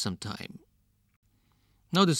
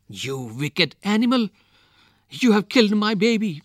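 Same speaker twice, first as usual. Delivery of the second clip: loud, rising storybook outburst; hysterical scream; 9 semitones higher, 7 dB louder than usual. A narrator's reading. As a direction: accuse loudly, climbing higher as it builds. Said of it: "she screamed hysterically".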